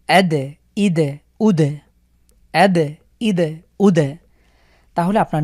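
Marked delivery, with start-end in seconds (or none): 0.1-4.0 দ of আদ ইদ উদ bounced; qalqalah on দ. Shown as a demonstration. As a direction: echo each দ. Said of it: "দাল দিয়ে যদি আমরা একটু পড়ি".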